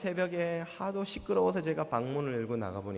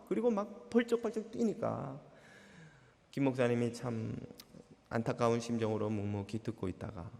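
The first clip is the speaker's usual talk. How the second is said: ordinary talk, nothing marked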